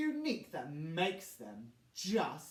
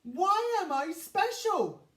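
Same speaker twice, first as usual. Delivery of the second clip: tearful; 7 semitones higher, 5 dB louder than usual. A narrator's reading. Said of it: "he says with a tear".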